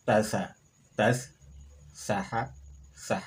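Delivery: tasa then tas, saha then sah, each final letter closed off; sukun pronunciation drill. Shown as a demonstration. Teacher drills sukun pairs for the class, firm and deliberate. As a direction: alternate open and closed syllables, clearly separated. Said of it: "Kita ulangi pelafalannya satu persatu".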